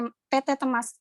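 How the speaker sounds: usual speaking voice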